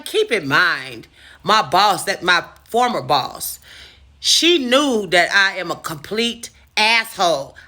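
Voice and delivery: usual speaking voice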